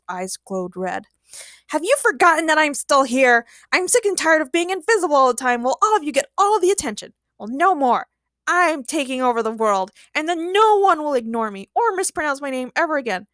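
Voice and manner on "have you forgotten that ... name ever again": high, aggrieved tirade; villain rant. A narrator's reading. Ignored villain announces his takeover, ranting. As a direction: rant like a slighted supervillain.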